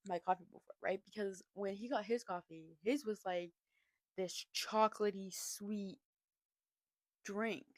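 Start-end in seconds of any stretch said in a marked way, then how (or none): none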